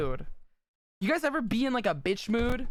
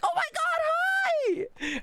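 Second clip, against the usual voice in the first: very high, slow, drawn-out squeal; gushing mock squeal; much slower than usual. Streamer mimics her own reply to a flirty chatter, squealing high and slow. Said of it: "And I'll be like".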